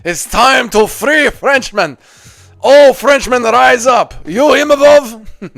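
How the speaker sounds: loud, slow crusader proclamation; righteous paladin declaration. Pretend. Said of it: "Can you do an impression of a Crusader B S D".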